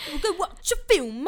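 high, loud put-on British voice; mock British accent. Repeats the mock British line.

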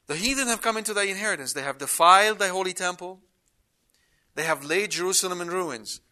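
quick rereading of the psalm lines; brisk rereading. Shown as a demonstration. Preacher rereads the verses to the congregation, quick. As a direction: rush through the verses again. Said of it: "Let's reread that again".

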